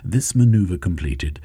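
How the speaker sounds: ordinary delivery, nothing marked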